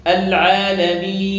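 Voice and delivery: العالمين chanted loudly, final ee held fully six counts; six-count madd. Shown as a demonstration. Teacher demonstrates third ruling, العالمين with full long stretch. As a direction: draw "meen" of العالمين out to the maximum. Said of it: "لنا فيه ست حركات".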